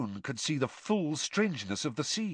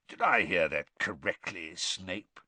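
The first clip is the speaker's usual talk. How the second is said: low, deliberate question; slow questioning; 5 semitones lower than usual. A narrator's reading. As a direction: ask it slowly, low in the voice. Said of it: "he asked slowly".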